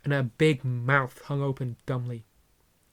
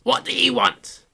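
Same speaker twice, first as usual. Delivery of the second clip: high, louder snap; suspicious aunt; 10 semitones higher, 7 dB louder than usual. Acted. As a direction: demand it at the door. Petunia at the door.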